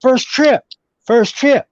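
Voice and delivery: high-pitched, eager welcome; delighted greeting. Retold or imitated. Man shaking a newcomer's hand, excited welcome. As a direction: greet a fellow traveller with sudden enthusiasm. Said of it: "the guy's whole attitude changes".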